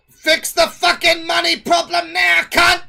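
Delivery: high, loud mock scream of the ranting lady; imitating her screaming. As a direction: bellow it like the furious woman in the shorts. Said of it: "my voice was pretty accurate to her screaming".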